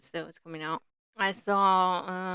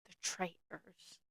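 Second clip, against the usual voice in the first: a soft, slower Irish "The traitors." with the "The" clipped off; lightly done Irish lilt on "The traitors."; Irish accent like Mara; 12 dB quieter, slower than usual. Shown as a demonstration. Podcaster copying Mara's Irish way of saying "The traitors.". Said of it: "That's how they say it".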